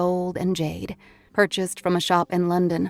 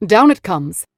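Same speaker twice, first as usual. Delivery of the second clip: loud, decisive announcement; determined; 10 dB louder than usual. A narrator's reading. Husband resolves to take the tapestry down, loud and determined. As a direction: say it at once with firm resolve, louder. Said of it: "determined now".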